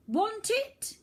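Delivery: put-on high, loud taunting boy voice; boy villain. A bratty boy taunts the children from the rock, high and loud. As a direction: toss the offer down like a bratty boy. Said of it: "he said".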